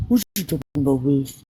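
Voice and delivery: low mock-suspicious catchphrase delivery; catchphrase imitation. Quoting a cute TV character's line, imitating him. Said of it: "I used to love his lips when he said that".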